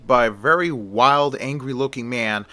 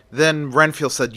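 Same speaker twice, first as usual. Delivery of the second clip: usual speaking voice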